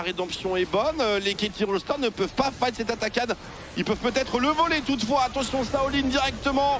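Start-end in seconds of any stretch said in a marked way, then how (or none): 3.8-6.8 fast rising play-by-play call; esports caster. Real, not acted. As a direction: call the teamfight as it builds. Live play-by-play building up. (commentateur esport survolté)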